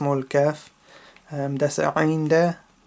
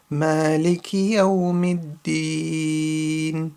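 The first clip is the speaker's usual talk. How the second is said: Quran recitation; 4 dB louder than usual. Shown as a demonstration. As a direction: recite each verse carefully as a teacher's model. Recites from the top again.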